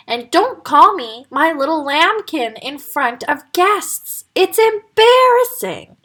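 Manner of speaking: slow, whiny protest rising high at the end; embarrassed son. Acted. Wolf scolding his mother before guests, embarrassed. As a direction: complain like a mortified teen.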